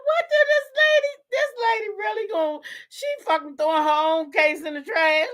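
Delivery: squealing high through real laughter; can barely get the words out for laughing. Real, not acted. Reacting to a lawsuit, laughing.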